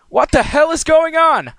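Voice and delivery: very high, loud bewildered yell; dazed man yelling at the ponies; confused shout. Acted. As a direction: demand answers while reeling from the fall. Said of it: "I asked quite reasonably".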